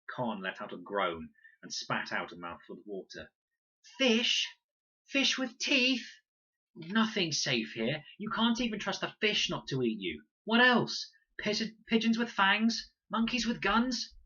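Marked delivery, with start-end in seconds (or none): 4.0-14.1 high, loud, slow indignant tirade; outraged rant. A narrator's reading. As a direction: rant in disbelief. Con ranting after near drowning.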